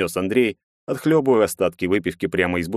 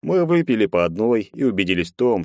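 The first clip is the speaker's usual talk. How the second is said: ordinary delivery, nothing marked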